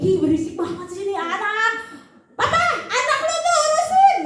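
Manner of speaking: put-on fed-up parent telling spouse to deal with the baby; annoyed parent. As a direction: shove the crying baby onto your spouse.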